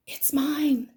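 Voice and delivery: hushed whisper from under the ground. Acted. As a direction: breathe it like a tiny seed. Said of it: "the seed whispered".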